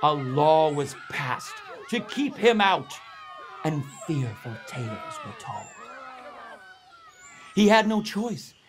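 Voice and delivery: usual speaking voice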